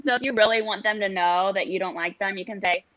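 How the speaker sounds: normal talking voice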